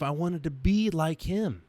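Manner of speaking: ordinary talk, nothing marked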